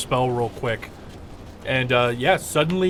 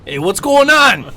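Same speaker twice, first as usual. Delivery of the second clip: a raised, loose street-tough griffin voice; 7 semitones higher, 9 dB louder than usual. Acted. Griffin greets the wizard who spoke to it, chummy.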